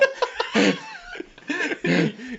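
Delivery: shrieking high laugh; high-pitched laugh. Real, not acted. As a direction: burst into a squeaky laugh. Cracking up at farming joke.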